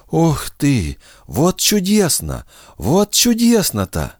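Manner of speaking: put-on high, slow exclaiming old voice; delighted grandmother. A narrator's reading. Grandmother rejoices at the reunion, high and delighted. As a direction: gush with joy.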